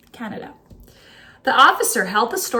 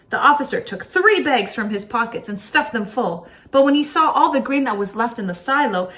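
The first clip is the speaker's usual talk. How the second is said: ordinary delivery, nothing marked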